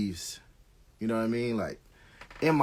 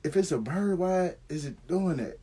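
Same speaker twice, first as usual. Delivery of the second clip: puzzled wonder; 5 semitones higher than usual. Retold or imitated. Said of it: "I'm like".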